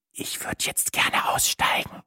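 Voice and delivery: slow, secretive whisper. Acted. Matze begs Sina to get off, slow whisper. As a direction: whisper it slowly, with no voice. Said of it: "flüsterte ich".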